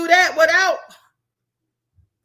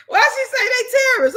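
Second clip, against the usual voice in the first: shocked, way up high; high-pitched disbelief; 11 semitones higher, 4 dB louder than usual. Real, not acted. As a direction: squeak of outrage. Outraged at comment.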